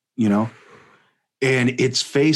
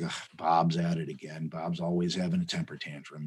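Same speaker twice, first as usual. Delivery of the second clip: weary annoyed; 7 semitones lower, 11 dB quieter than usual. Retold or imitated. Tigers voiced annoyed at Bob.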